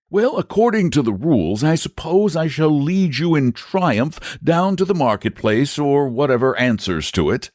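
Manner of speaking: high knight voice, explaining; Saint George, matter-of-fact. A narrator's reading. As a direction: recite the rules of combat.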